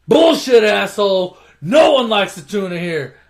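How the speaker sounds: loud, high mock-furious outburst; angry shout. [name] challenging Paul over the tuna, angry shout. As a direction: act out a bully starting a fight. Acted quarrel leading to fight.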